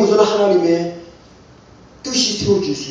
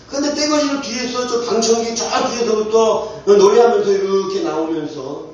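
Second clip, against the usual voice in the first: normal talking voice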